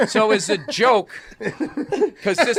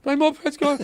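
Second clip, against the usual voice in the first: high, whiny quote of the panicked friend; freaked-out friend; 8 semitones higher than usual. Retold or imitated. Telling the prank story, mimicking the friend's panicked high whine. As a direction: squeak it out like he's about to cry.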